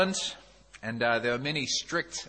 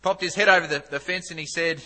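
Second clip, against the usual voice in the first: ordinary talk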